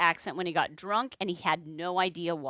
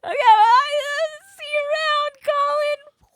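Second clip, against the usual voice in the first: much higher, loud squeaky nervous voice; nervous squeak; 9 dB louder, slower than usual. Retold or imitated. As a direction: squeak it out, trying to get away. Nervously escaping drunk Colin.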